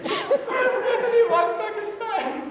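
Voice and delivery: high, helpless laughing through the words; really losing it laughing while telling the story; cracking up. Real, not acted. Friend telling a funny dorm story, cracking up.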